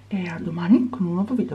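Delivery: soft goodnight whisper. Real, not acted. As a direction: whisper it gently. Whispers again, someone sleeping.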